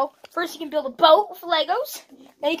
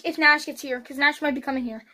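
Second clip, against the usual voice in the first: normal talking voice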